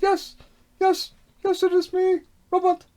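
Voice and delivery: mock young self answering; childish reply. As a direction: answer her like the boy she called. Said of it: "And I was like".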